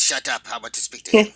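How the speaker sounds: loud, stern master; stern command. Pretend. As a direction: bark it like a dark lord. Sith master snapping at apprentice.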